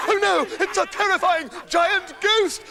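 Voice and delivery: mock frightened shout. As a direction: yell as if you saw a ghost.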